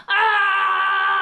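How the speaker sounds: very high, very loud shout; wild playful yell; tantrum yell. Shown as a demonstration. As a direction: let out a full tantrum scream. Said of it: "I want you to make some noise".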